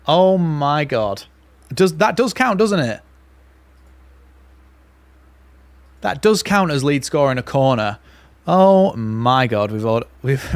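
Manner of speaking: loud, breathless and laughing, words tumbling over each other; giddy disbelief. Real, not acted. Fan to viewers, stunned that the corner counted, giddy. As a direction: still reeling, half laughing, can't believe it.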